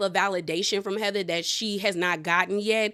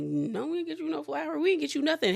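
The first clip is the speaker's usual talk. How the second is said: sheepish denial; 5 semitones higher, 5 dB quieter, faster than usual. Retold or imitated. Guests sheepishly deny bringing flowers.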